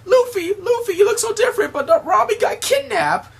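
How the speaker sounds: very high, loud squeaky little critter voice; Chopper's voice. Retold or imitated. Chopper alerts fake Luffy that Robin's gone, very high and loud. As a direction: pitch way up like a tiny frantic reindeer.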